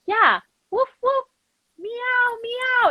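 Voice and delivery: high playful woof woof then meow meow; acting out pets with animal noises; imitated dog and cat sounds. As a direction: bark and meow like a game of charades. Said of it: "And I was like".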